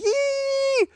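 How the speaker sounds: piercing falsetto squeal like a strangled chipmunk; imitated shrieking toilet whistle; high whistle imitation. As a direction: squeal it as high as you can. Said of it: "that horrible high pitch".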